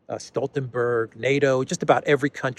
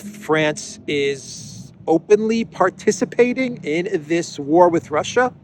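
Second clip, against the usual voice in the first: higher, louder mocking paraphrase, dripping with irony; sarcastic paraphrase; 5 semitones higher, 3 dB louder than usual. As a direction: spell out the hidden meaning with a sneer. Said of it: "that's kind of how, uh, this statement sounds".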